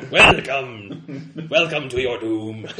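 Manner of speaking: hammy ominous welcome, laughing; creepy caretaker voice. Pretend. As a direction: ham up a creepy host greeting.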